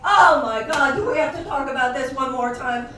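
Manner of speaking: hammed-up groan of annoyance; mock exasperated outcry. Dinner guest moaning about the topic again, loud mock whine. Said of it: "if they say".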